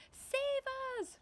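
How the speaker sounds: high frightened plea; panicked cry. Acted. Miss Spider crying out as the peach falls, panicked. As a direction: scream to be saved. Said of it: "cried Miss Spider".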